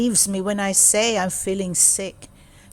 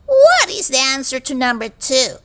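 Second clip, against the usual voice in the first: high, louder demanding teacher voice; mean teacher; 5 semitones higher, 6 dB louder than usual. Acted. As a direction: snap the question at the pupil. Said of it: "She says".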